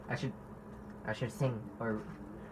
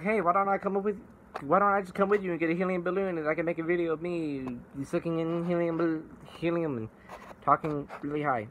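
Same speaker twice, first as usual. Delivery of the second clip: higher-pitched imitation of his own eager asking; eager retold pitch; 6 semitones higher than usual. Son asking mom to take him along, mock-eager and higher. Retells pleading with mom for helium.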